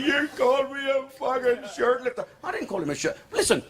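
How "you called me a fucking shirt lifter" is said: high, offended mock voice; indignant Brian. Brian accusing the comic, high and offended. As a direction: squeal it as a wounded accusation. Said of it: "And he".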